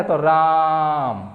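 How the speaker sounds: राम with the आ held long and slow; teacher stretching the vowel in राम; prolonged ram. Shown as a demonstration. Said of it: "उच्चारण अगर देर तक किया जाए".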